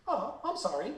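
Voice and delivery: imitating dad's offhand, careless apology; nonchalant. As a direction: toss it off like it costs nothing. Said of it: "You just did that nonchalantly".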